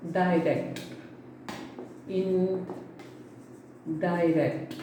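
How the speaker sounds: "Direct, indirect" said with a DAI sound in "direct"; demonstrated pronunciation. Shown as a demonstration. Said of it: "it should be pronounced like this".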